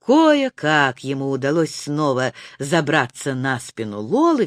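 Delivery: ordinary delivery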